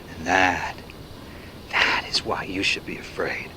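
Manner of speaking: low ominous whisper. Acted. Narrator telling listeners why to be afraid, whispered. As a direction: whisper the warning.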